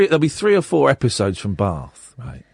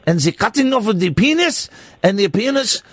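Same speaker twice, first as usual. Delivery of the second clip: mock-solemn Swiss accent; silly, earnest Swiss villager's accent; 3 semitones higher than usual. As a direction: proclaim in a hammed-up Swiss accent. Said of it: "It's a Swiss accent".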